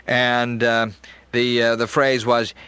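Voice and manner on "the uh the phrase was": ordinary talk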